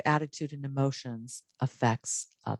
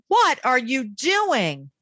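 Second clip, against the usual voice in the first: very slow, loud, high, What and doing both punched; drawn-out, incredulous-style demand hitting What and doing; exaggerated emphasis; 10 semitones higher, 5 dB louder, much slower than usual. Shown as a demonstration. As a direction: hit What hard, then swoop loudly through doing. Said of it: "change it one last time".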